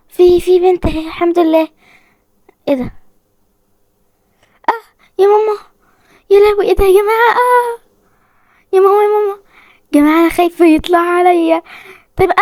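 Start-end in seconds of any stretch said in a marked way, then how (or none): 4.7-5.6 high startled cry of fear; scared squeal; frightened cry. Real, not acted. Jump scare in horror game. 6.3-9.4 high, fast panicked cries; real fright, pitched up. Real, not acted. Panicking at monster in game.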